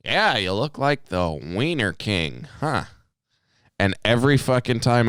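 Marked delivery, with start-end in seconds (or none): 0.0-2.8 imitating a slow, casual drawl; nonchalant and slow. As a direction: stretch it out as an offhand tease. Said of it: "very nonchalantly and very kinda low and slow".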